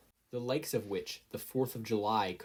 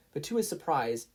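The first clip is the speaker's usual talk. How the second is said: ordinary delivery, nothing marked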